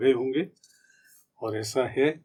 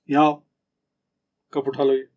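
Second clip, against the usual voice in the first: put-on a bit louder, firmer call to a child; 3 dB louder than usual. Shown as a demonstration. Parent firmly calling a child who left the cup, firm. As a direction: call the kid over firmly. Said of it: "और बच्चा है कोई".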